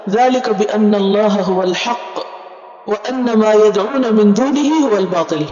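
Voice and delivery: measured, chanted recitation of the Arabic verse; Quranic recitation. Acted. Teacher recites the verse to the class in chanted recitation.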